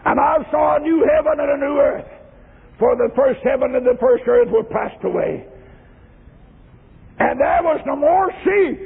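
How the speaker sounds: ringing preacher's recitation, lifting at phrase ends; solemn revival proclamation; declaimed scripture. Acted.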